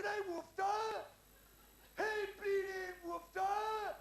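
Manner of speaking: long, high bellowed military call; mock guardsman's roar, drawn out; parade-ground shout. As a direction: stretch each word like a drill sergeant.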